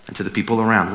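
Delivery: normal talking voice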